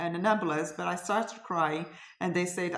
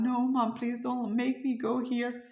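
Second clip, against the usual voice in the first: higher, louder begging; mock-tearful protest, raised pitch; imitated tearful plea; 7 semitones higher, 3 dB louder than usual. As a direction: plead like a crying daughter. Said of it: "And she's crying".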